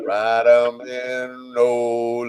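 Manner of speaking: loud, stretched-out holler; drawn-out cheer. Pretend. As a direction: drag the words out like cheering from the stands. Mock cheering at a game.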